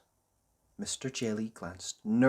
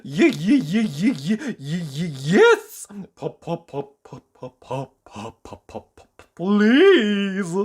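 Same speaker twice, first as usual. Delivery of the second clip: trembling stutter, loud high bursts; shaking stammer; 8 semitones higher, 11 dB louder, slower than usual. Acted. Mister Jelly answering the tramp, stammering in fear. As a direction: quiver through each syllable. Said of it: "quivered and shook and said".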